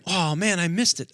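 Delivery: high, loud retold lament; apologetic dismay. First officer owning up to his captain, acted apologetic dismay. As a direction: act out a first officer kicking himself. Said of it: "he's really apologetic".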